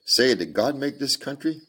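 put-on high childlike question; a small girl wondering aloud; curious little girl. Little girl voiced in story.

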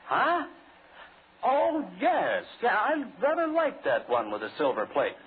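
lightly mocked high old man voice; a hint of the coffin seller's voice, mocking him; mimicking old man. Mocking the old coffin seller for his colleague, a hint of his voice. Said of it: "If, uh, I were you, Ed".

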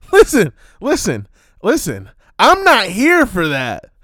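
loud, high, slowed insistence; loud fed-up listen; exasperated rant. Acted. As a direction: hammer home that you're done with it. Ranting to audience.

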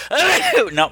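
sudden sneeze mid-sentence; sneezing. Real, not acted. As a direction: break off with a sneeze. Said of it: "I'm going to sneeze".

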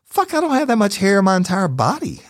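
high, strained disbelief; high incredulous inner voice. Retold or imitated. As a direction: sound baffled and grossed out. Staring at the hair, incredulous.

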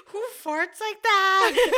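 slow, loud laughing question. Retold or imitated. Mom dying of laughter.

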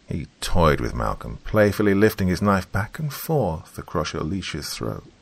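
ordinary delivery, nothing marked

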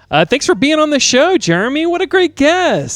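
high, loud sign-off; excited. Acted. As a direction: gush it like a game show host. Host's hammed-up sign-off.

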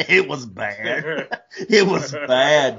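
real laughter through the words; laughing. Real, not acted. Recalling gutting a turkey, laughing hard. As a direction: laugh it out helplessly. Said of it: "laughing at his own misery".

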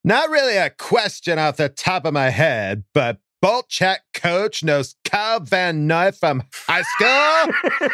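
put-on high, slow valley girl drawl; ditzy Valley Girl voice. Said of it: "The Valley Girl".

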